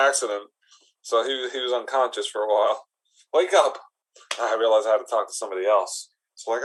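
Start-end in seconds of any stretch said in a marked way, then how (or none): 3.3-3.8 loud wake-up call. Acted. Shouting to wake unconscious man.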